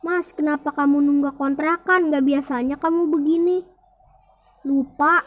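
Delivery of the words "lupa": usual speaking voice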